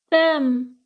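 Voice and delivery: a forgiving ghost speaking from far away; ghostly voice. A narrator's reading. Bedelia's spirit comforting Myrtle, slow and calm.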